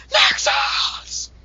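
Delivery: screamed, strained cry; hero name shout. Acted. Announcing what comes after the Next, shouted. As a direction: yell it like a battle call.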